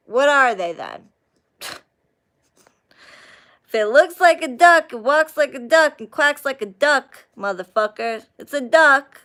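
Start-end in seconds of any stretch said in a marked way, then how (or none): 3.7-9.2 raised, higher and louder, hammering each duck; emphatic rant. Real, not acted. Ranting to viewers about Arabic countries, loud and emphatic. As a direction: drive the point home like it's obvious.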